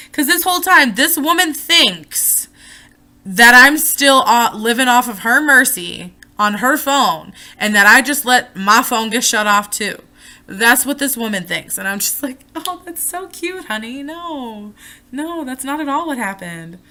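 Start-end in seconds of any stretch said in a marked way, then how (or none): 0.1-11.8 loud, indignant ranting. Real, not acted. Ranting about mom. 12.5-16.8 higher, sugary condescension; mocking her mother with a sugary, condescending higher voice; mock sweet. Mock sweetness toward mom.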